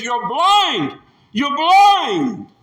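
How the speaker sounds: Jesus condemning the proud, rising to a shout; forceful declaration. Acted. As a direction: hammer it home.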